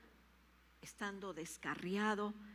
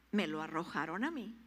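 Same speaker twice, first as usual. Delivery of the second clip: ordinary delivery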